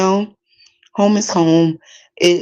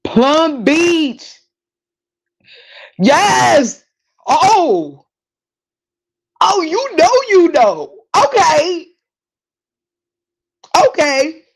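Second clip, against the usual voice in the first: high, excited squeals; thrilled recognition; 11 semitones higher, 4 dB louder, much slower than usual. Real, not acted. Hearing a viewer knows Plum Beach, high excited exclamations. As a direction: cry out high, delighted that someone knows.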